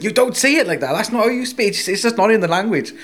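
high-pitched mock correction; imitating an insistent friend. Friend dismisses his Spanish as not in the language, mock insistence. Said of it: "at the point he's going".

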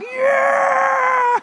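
high, loud whooping yeah; triumphant shout. Retold or imitated. Driver cheering the cop, whooping yeah. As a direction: cheer as the speeder gets pulled over. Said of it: "I'm like".

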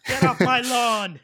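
put-on high, slow cranky old-timer voice; grumpy old man. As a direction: shout it like a crabby old neighbor. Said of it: "I sound like an old person".

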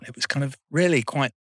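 usual speaking voice

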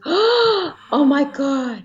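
slow, drawn-out awed gasp; stunned gasp. Retold or imitated. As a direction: let it out slow in shock. Mum sees baby's head.

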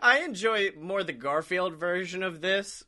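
ordinary talk, nothing marked

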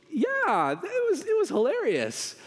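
squeaky high-pitched lie, trying too hard; nervous high fake praise; high fake enthusiasm. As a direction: pitch way up to sell a fib to his wife. Husband faking enthusiasm to wife.

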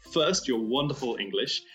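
usual speaking voice

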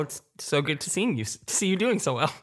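ordinary talk

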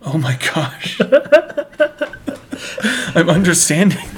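retold awe breaking into laughter; mock wonder, laughing through it; laughing amazement. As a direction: crack up while reliving your own silly realization. Laughing at own past amazement.

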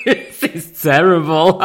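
cracking up, slowly through high laughter; helpless laughter. Real, not acted. Teller cracking up at her own turtle's head story, helpless laughter. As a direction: laugh so hard the words barely come.

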